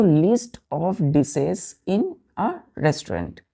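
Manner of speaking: very slow, word by word; teacher repeating the definition slowly; slow dictation. Shown as a demonstration. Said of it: "pronunciation".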